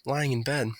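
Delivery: usual speaking voice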